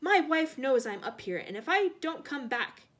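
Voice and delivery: loud, high warning; bold bluff. A narrator's reading. Frank bluffing the killer about a wife, loud defiance. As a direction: bluff forcefully, full of defiance. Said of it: "he added on a sudden inspiration".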